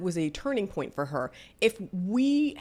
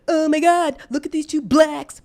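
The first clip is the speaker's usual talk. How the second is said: shrieked high and loud in disgust; loud, disgusted mock shriek; 11 semitones higher, 11 dB louder than usual. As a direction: yell it out as the sneering girl. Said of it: "and she's like".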